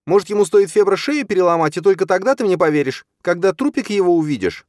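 loud, high-pitched, rushing tirade; hysterical rage. A narrator's reading. Stepmother demanding her husband believe her, hysterical rage. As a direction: shriek the bitter question at him.

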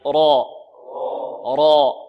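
را loud, with a full dark vowel; emphatic, rounded-back را for students to repeat; heavy raa. Shown as a demonstration. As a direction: drill را thick and heavy, held out. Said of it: "التفخيم".

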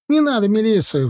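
high-pitched panicked plea; acted yelp of fear; sudden squeal. A narrator's reading. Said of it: "вдруг взвизгнул Титок".

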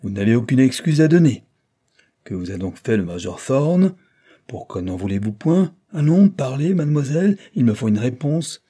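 angry scolding. A narrator's reading. Judge berating his silent daughter, loud anger. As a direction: hammer her with demands.

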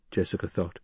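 ordinary delivery

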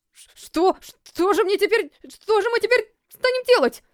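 high, panicky helpless voice. A narrator's reading. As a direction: voice rising in helpless alarm. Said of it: "беспомощно спросил воин". (беспомощно, в растерянности)

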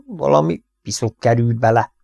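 ordinary delivery, nothing marked

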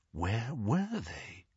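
low, soft, slow question; quiet, menace-laden wondering; hushed unease. A narrator's reading. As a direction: drop to a slow, wary hush. Harry fearfully scans the empty darkness.

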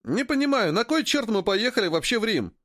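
high-pitched indignant grumble; indignant young trader, pitched well up. A narrator's reading. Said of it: "возмущался Поганка".

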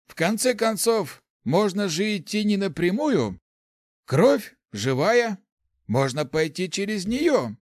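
put-on high-pitched lively old mage; Lars, elderly mage. A narrator's reading. Old mage admitting ignorance to his colleague, high and animated.

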